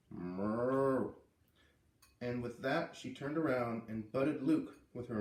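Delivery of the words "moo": bellowing moo. A narrator's reading. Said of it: "another loud, belligerent, bellowing".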